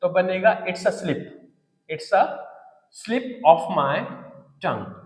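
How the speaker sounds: slow, with it's clipped short; it's said as one short word, slow and clear; slow contracted form. Shown as a demonstration. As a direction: show the short form slowly. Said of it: "को short में बोलते हैं it's".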